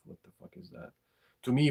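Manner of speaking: ordinary talk, nothing marked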